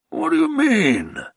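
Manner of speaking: a tipsy nobleman begging to ride away; the Baron, pleading. Acted. Baron puzzled.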